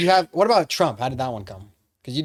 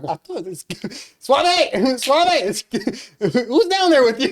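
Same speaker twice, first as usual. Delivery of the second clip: startled mom; 12 semitones higher, 7 dB louder than usual. Retold or imitated. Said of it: "She's like".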